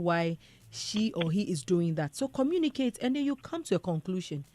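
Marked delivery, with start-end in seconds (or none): none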